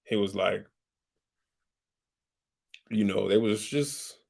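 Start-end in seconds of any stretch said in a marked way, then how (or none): none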